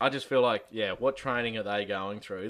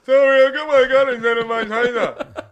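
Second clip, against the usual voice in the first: high, loud, laughing fake sorry; laughing mock apology; 9 semitones higher, 13 dB louder than usual. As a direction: play a cop laughing off a mistake. Mock cop apologising for shooting.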